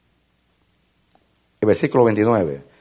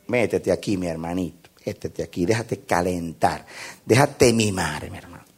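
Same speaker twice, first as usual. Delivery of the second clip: tender coaxing; 5 semitones lower, 5 dB quieter than usual. Acted. Acts warming a cold brother.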